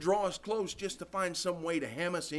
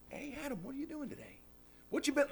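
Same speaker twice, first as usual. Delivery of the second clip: gentle God voice; 7 semitones higher, 5 dB quieter, faster than usual. Pretend. God visiting Adam gently.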